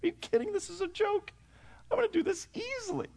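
put-on high, boastful little-boy voice; cocky kid inner voice. Boy sure he can hold the shells, high cocky voice. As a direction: brag to yourself like a cocky seven-year-old. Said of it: "in my mind going".

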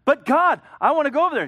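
put-on high, loud childish whine; whining plea. Preacher voices Paul whining to God, high and loud. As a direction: beg like a stubborn kid. Paul pleading against God's no.

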